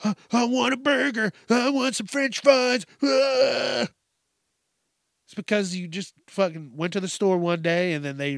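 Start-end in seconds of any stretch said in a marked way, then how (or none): 0.0-3.9 put-on high-pitched whining kid demanding food; whiny complaining. Kids complaining for burgers and fries, high mock whining. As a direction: whine it high, ending in a wail. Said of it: "they're bitching and complaining".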